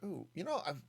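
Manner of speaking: ordinary talk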